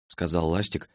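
ordinary delivery

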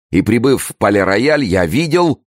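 very high, urgent telling; agitated report. A narrator's reading. Gourville reporting from the Palais-Royal, agitated. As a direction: push the news out, voice climbing. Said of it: "ты задыхаешься".

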